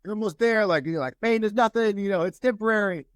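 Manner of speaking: coach urging. Retold or imitated. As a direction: drive the player on like a coach. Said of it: "coach is telling him".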